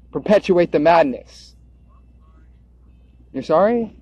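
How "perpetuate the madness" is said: louder, punchy repeat of "Perpetuate the madness"; emphatic repeat. Real, not acted. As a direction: relish the phrase, hit "Perpetuate". Repeats his own phrase, savoring it.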